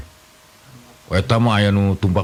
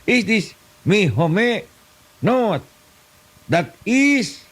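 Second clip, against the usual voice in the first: much higher, slow mock English; broken English; 10 semitones higher, much slower than usual. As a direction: ham up clumsy English. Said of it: "Bahasa Inggris tapi kepakojot". (bahasa Inggris ngawur, nada tinggi dibuat-buat)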